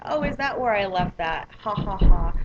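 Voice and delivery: retold mock-innocent quip capped with a loud fake laugh; playful retort with put-on laughter; joking comeback. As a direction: toss it back, then a punched, deliberate ha-ha-ha. Joking comeback to brother's mockery.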